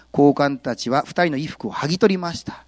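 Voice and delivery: usual speaking voice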